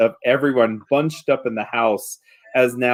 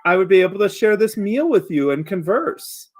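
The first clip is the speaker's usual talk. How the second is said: higher-pitched, politely coaxing creature; pleasant creature, coaxing; 9 semitones higher than usual. Acted. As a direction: coax them to let you in. Creature outside speaking.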